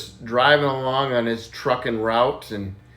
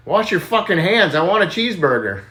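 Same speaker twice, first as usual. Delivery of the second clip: barked order; 6 semitones higher, 4 dB louder than usual. Acted. Trucker's blunt punchline order to bartender.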